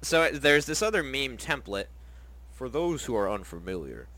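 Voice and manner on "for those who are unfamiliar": 1950s narrator voice. Real, not acted. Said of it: "Why do you say it like you're, like, a old-timey audiobook narrator?".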